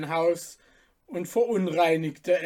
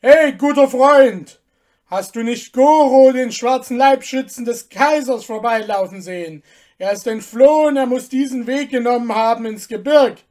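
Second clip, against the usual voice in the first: higher, loud shout, urgent; shouting leader; 6 semitones higher, 11 dB louder than usual. Acted. Soldiers' leader shouting at Abner for the runaway slave. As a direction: bellow it across the valley. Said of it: "der Anführer schrie ihn an".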